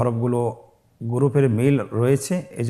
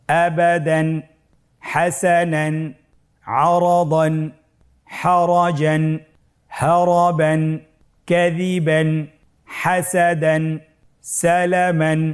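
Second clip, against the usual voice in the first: whole words in a slow, level chant; even, drawn-out recitation for learners; reading without spelling; 4 semitones higher, 3 dB louder, much slower than usual. Shown as a demonstration. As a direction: recite each word joined up, stretching the long vowels. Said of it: "বানান ছাড়া একবার বলি".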